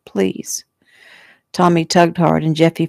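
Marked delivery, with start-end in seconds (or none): 0.1-0.7 soft, hushed begging; whispered plea. A narrator's reading. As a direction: beg barely above a whisper. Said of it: "she whispered".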